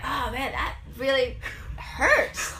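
drawn-out, hammed-up groaning words; mock agony, stretched out; selling the pain. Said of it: "like, selling".

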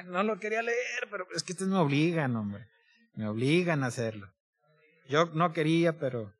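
soft, put-upon aside; mock reluctance. Preacher joking that the congregation obliges him to read, mock reluctant. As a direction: mildly protest under your breath, as if forced.

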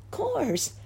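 put-on very high little-girl voice; reassuring child. A narrator's reading. Girl assures the clown.

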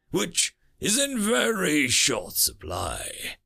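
slow venomous alien threat. Acted. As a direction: drag out each word with malice. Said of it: "Raw venom came from its mouth".

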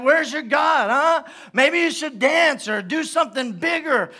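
high-pitched jeering challenge; mocking taunt. Elijah taunting the prophets of Baal, high mocking voice. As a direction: goad them with mock suggestions. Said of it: "he starts mocking these prophets".

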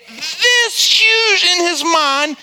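"this huge" drawn out very slowly and pitched high; exaggerated stretch. Real, not acted. Pastor showing how fear inflates things, "this huge" hugely drawn out.

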